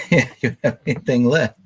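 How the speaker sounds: high, loud laughing reply. Real, not acted. Listener agreeing with a grim polar bear joke, laughing. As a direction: say it through a laugh.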